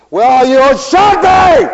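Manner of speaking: loud, high, slow sneering "Well, you should be!"; indignant retort as heard. Retold or imitated. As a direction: drag it out with righteous scorn. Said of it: "you didn't say it that way at all".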